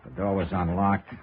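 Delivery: measured storyteller narration over the music; radio drama narration. A narrator's reading. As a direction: tell it to the audience like a hard-boiled detective. First-person narration over music.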